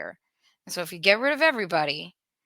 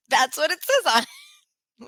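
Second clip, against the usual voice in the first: laughing; 9 semitones higher, 3 dB louder, faster than usual. Real, not acted. Laughs at Democrat label.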